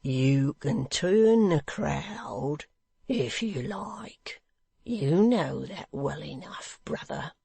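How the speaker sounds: very slow, half-whispered; slowly. Acted. The hangman warning Hugh, very slow and hushed. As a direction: draw it out menacingly. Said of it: "said the hangman slowly".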